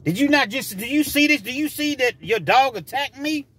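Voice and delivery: high-pitched incredulous demand; indignant retort. Retold or imitated. Bitten driver to dog owner, indignant.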